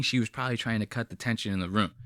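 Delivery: usual speaking voice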